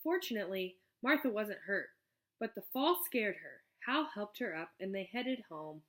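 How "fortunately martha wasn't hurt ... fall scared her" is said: ordinary delivery, nothing marked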